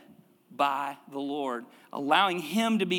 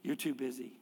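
normal talking voice

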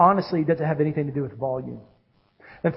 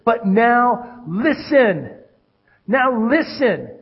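drawn-out, raised call for attention; commanding God; 6 semitones higher, 4 dB louder, much slower than usual. Acted. God summoning his people to listen, commanding. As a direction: stretch it out like a summons. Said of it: "He says".

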